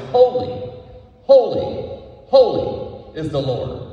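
loud, high proclamation, rising to a call; crying out. Acted. Preacher voicing the seraphim's cry to the congregation, loud and high. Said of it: "what they cried out was".

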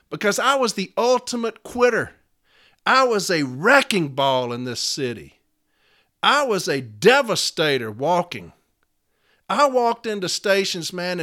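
loud, heated confession to a crowd; impassioned self-rebuke. Real, not acted. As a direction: hammer the key words in fierce self-accusation. Impassioned self-rebuke before the audience.

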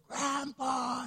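put-on high little boy's call, Grandpa!; child calling out. Grandson calling down from the jungle gym, high child voice. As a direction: call up like a small grandson from the castle top. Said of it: "and he'd yell".

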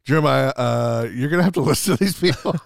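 hammed-up Randy pushing him to talk; imitating Randy. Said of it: "He's like".

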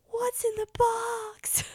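slow, high, whispered desperate plea; imitating Brad Pitt's whispered Seven line. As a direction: quote the anguished movie line. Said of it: "from Seven, from Brad Pitt".

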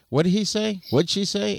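high-pitched mock pestering of a wife; high, insistent retold questions. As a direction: raise the pitch, imitating your own repeated asking. Imitates himself pestering his wife.

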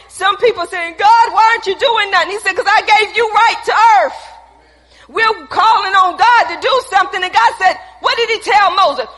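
loud, high-pitched preaching; fiery preaching. Acted. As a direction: preach it with full fire. Preaching at full force.

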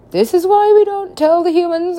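high, loud alien scolding; exasperated alien. Retold or imitated. Voices exasperated aliens answering him.

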